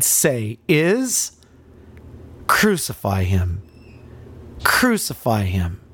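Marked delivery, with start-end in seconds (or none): 4.6-5.8 unvoiced hushed whisper. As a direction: breathe the words out, no voice. Same words repeated whispered.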